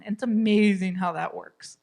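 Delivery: normal talking voice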